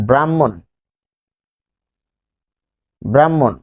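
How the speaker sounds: ব্রাহ্মণ said "bram-mon"; doubled m. Shown as a demonstration. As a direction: hold the m in ব্রাহ্মণ, h silent. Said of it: "এতে ম দুইবার উচ্চারিত হয়".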